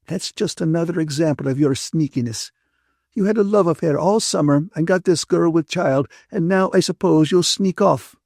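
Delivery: cross Ferguson, scolding. A narrator's reading. Ferguson rebukes the man who got Catherine into a mess, scolding. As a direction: scold them as the disapproving friend.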